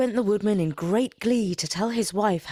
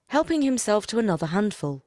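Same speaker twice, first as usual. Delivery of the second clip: plain reading voice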